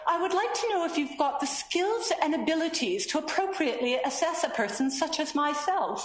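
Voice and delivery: high, mock upper-class British voice; upper-class British accent. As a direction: imitate a very formal upper-class British speaker. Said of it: "only speaks in an upper-class British accent".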